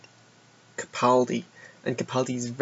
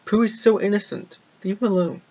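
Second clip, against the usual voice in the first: protective plea; 7 semitones higher than usual. Retold or imitated. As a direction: plead to leave the poor bear alone. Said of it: "I'm like".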